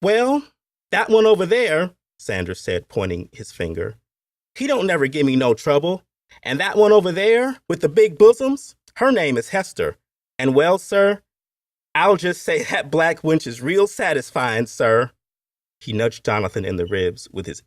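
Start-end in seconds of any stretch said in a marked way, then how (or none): 0.0-2.0 high, loud Appalachian overseer; overseer pointing out. A narrator's reading. 4.5-15.1 high, loud, crude overseer voice; coarse Appalachian overseer. A narrator's reading. Overseer figure.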